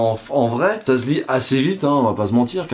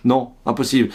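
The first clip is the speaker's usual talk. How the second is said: ordinary delivery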